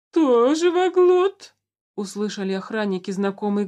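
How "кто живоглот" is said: slow loud menacing ruler; a ruler demanding, slow and cold; dark lord. A narrator's reading.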